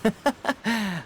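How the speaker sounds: laugh, pleased it's settled; a gentle, knowing little laugh; satisfied chuckle. Acted. Detective pleased with confession.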